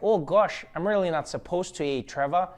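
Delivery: put-on overly polite Canadian border guard, Canadian accent; hint of a Canadian accent, eh. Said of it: "I don't even know if that was a Canadian accent".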